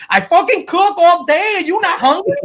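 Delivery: imitating an angry wife, high, loud Spanish accent; hammed-up Spanish-accented wife, high and yelling; Spanish woman voice. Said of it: "I came up with that voice".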